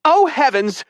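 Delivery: high, prim, shocked man's voice; fifties housewife gasp. A narrator's reading. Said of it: "like a nineteen fifties housewife".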